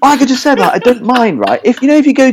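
high, exasperated whinge about being ripped off; exasperated rant. Real, not acted. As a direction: pitch it up in disbelief. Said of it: "ranting about the car bill".

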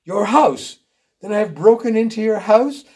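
high, loud horrified disbelief; sudden cry. A narrator's reading. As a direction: cry out in shocked realization. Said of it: "Robert gave a sudden cry".

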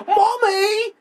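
squeaky, very high mock Cockney cry; shrill cartoon Cockney from the game; mock Cockney villager squealing from the Fable game; Cockney accent. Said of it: "British fucking Cockney accents".

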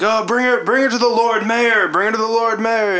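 high-pitched urgent calls; urgent townsfolk. Acted. Townspeople send body to Lord Mayor, urgent.

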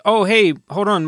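ordinary talk

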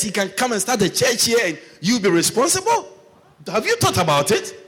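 high, loud self-rebuke; mock self-scolding; scolding himself. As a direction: tell yourself off. Scolding himself in retold thought.

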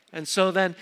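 ordinary delivery

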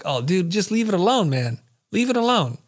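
lightly acted begging; mild pleading. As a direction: talk a friend down, pitch climbing. Begging angry winner to drop it.

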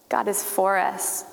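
usual speaking voice

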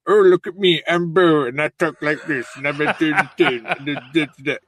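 a light mocking voice of a friend; mocking impression of Bo. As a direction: ham up a silly take on your co-host. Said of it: "I do the voice".

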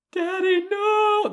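high, loud mock wail of kids. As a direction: imitate the kids wailing no. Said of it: "crying, wailing".

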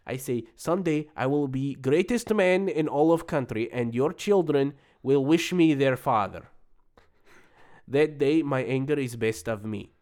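put-on Russian accent, grandly declaiming. Immigrant reviewer recounting his job interview and letters, Russian accent. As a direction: play the boastful Russian immigrant, accent all through. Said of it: "I can do it in an accent".

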